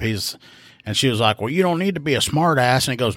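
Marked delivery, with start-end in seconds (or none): 1.4-2.9 higher-pitched retold retort; upset caller. Caller scolding the rookie's reaction, mildly offended. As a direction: lightly mimic an offended caller. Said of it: "she's upset because her electric bill".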